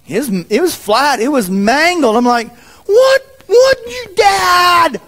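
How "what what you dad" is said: a sudden high shout breaking into a sob; shocked cry. Retold or imitated. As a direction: cry out in shock at the ruined bike. Retold shock at mangled bike.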